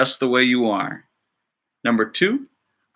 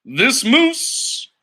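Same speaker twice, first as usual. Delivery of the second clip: higher, eager reveal of the astronaut dream; excited narrator; 9 semitones higher, much slower than usual. Acted. Teacher reading the moose's dream to students, excited and higher. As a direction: announce the twist with glee.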